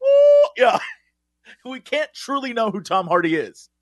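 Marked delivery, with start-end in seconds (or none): 0.0-0.5 high, loud "ooh"; mock ear-splitting celestial note; comic burst of a booming-god sound; voice of God wail. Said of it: "voice of God moments".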